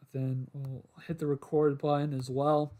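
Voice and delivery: ordinary talk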